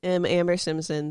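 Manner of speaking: usual speaking voice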